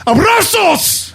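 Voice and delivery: high, drawn-out comic yell; mock bellow in a very high voice; all-caps dad. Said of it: "it's always in all caps".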